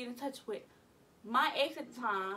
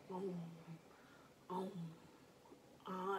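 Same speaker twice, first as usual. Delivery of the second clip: slow dazed ums; 5 semitones lower, 10 dB quieter than usual. Retold or imitated. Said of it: "eating in slow motion".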